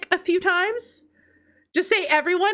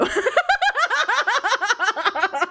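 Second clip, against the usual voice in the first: long laugh; 4 semitones higher than usual. Real, not acted. Laughs after teasing remark.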